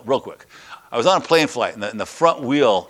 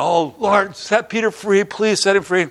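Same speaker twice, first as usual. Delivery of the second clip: mock fervent prayer; 7 semitones higher than usual. Church praying, voiced.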